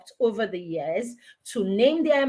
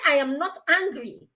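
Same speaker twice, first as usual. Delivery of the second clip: high-pitched, emphatic denial; mock-indignant, escalating denial; acted angry insistence; 4 semitones higher than usual. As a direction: insist on every word, hotly. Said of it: "You're obviously angry now".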